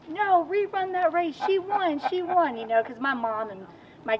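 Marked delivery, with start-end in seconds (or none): 0.1-2.6 imitating a crowd, loud and high, slowing down; excited crowd cheer. Retelling the race audience demanding a rerun, loud excited cheer. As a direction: shout it like the stands protesting the result. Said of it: "the whole audience was like".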